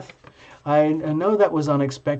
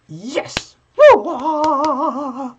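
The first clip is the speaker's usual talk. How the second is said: high, whooping yes and woo; excited cheer; 11 semitones higher, 5 dB louder, much slower than usual. Real, not acted. Host cheers the gamer viewer, excited whoops. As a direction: cheer like you just won.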